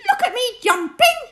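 high fast excited squeak; a small child showing off; squeaky Roo. Acted. Baby Roo shows Kanga, squeaking high and quick. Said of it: "squeaked Roo".

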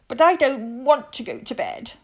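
high, whiny little cub; whiny child voice. A narrator's reading. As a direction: whine it like a stubborn child. Whiny child refusing bedtime.

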